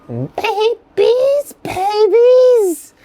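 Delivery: very high, drawn-out squeal; high squealed cry. Acted. Poet announcing the podcast's name, high squealing cry. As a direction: leap way up and hold each word.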